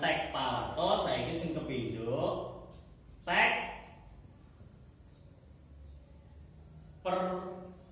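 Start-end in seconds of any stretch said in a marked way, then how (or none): 3.3-7.9 dictating. Shown as a demonstration. Repeats the topic for note-taking.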